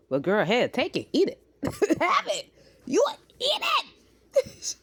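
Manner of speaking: high, loud, laughing take it, eat it; laughing give-up. Retold or imitated. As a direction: throw up your hands in laughter. Mom giving up, laughing.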